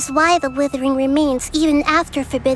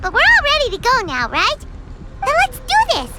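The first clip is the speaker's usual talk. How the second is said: higher, fast and eager; eager rally; 9 semitones higher than usual. Acted. Paimon rallying the group to set off, eager. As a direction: pump everyone up.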